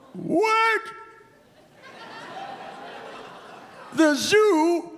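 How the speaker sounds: a hammed-up elderly grandpa rambling; old grandpa.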